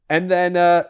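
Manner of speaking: ordinary talk, nothing marked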